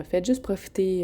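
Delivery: ordinary talk, nothing marked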